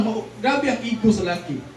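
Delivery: normal talking voice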